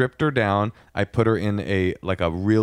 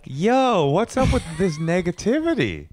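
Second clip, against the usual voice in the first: high-pitched, exaggerated hype-guy protest; playful high-voiced objection; mock upbeat protest; 8 semitones higher than usual. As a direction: cry it out high, stretching the words. Mock upbeat objection to partner's gloom.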